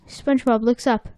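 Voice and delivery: ordinary delivery, nothing marked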